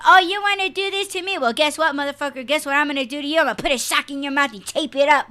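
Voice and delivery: loud mocking menace at a partner; mock threat.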